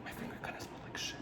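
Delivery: very soft, quick whisper; whispered aside. Real, not acted. Noticing her fingers smell, whispered aside. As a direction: murmur it to yourself, barely audible. Muttered aside while doing makeup.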